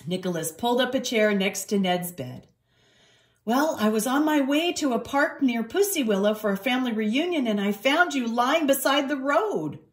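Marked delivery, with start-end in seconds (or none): 3.4-9.8 lightly voiced cat, pitched higher; earnest young cat. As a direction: explain earnestly in a raised voice. Voices Nicholas explaining.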